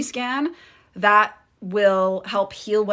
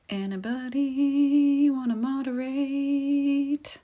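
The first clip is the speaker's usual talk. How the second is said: higher, slow sing-song call; drawn-out appeal; 5 semitones higher, slower than usual. Real, not acted. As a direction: stretch it out, calling for any volunteer. Calling for a volunteer among viewers.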